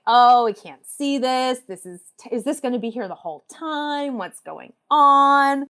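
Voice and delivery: grumbling audience. Retold or imitated. Audience members complaining about a blocked view, grumbling.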